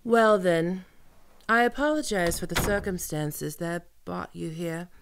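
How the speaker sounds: normal talking voice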